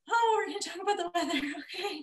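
high-pitched mocking put-on voice; mock small-talk reluctance. Small-talk hater facing weather chat, high mocking voice.

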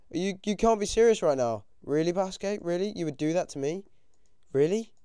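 high, loud pleading protest; indignant disbelief. Real, not acted. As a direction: protest as if personally betrayed. Indignant at what opponent did.

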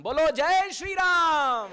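loud, high, drawn-out devotional cheer; long, ringing call for a response; rallying shout. Real, not acted. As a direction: call out to rouse the congregation. Rousing congregation to respond.

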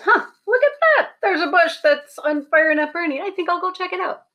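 high, bright 'huh, look at that' then rushing on; curious surprise. Acted. Moses, spotting the burning bush, amazed and eager. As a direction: Moses marvels at a bush, then hurries to see.

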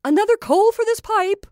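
witch's shrill demand for a coal; high sharp cry. Acted. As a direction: bark the order up high. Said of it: "cried she in her high, sharp tone".

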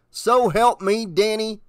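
exasperated father's growl; growled threat. A narrator's reading. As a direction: warn the boy through rising temper. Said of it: "he growled".

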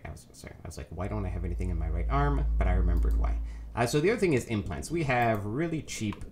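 usual speaking voice

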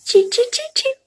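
high chanting chew chew; singsong. Real, not acted. Coaching baby to chew.

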